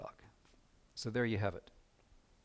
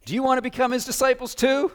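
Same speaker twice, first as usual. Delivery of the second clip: higher, louder cheeky challenge; 11 semitones higher, 7 dB louder than usual. Retold or imitated. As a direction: toss the question back with cheek. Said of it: "A little bit of cheek on this guy".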